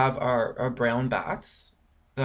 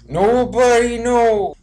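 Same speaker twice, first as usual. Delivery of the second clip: drawn-out, high, loud cry of disbelief; exasperated outburst; 9 semitones higher, 8 dB louder, slower than usual. Real, not acted. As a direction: throw it up to the heavens, slowly. Exasperated rant about mystery deaths.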